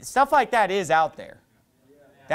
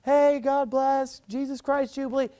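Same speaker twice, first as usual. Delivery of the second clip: nice guy greeting; 7 semitones higher than usual. Retold or imitated. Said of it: "this real nice".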